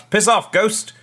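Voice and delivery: loud, high dismissive yell; shouting at an unseen ghost to go away. Acted. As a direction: blast it at the ghost, annoyed. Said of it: "just shouts".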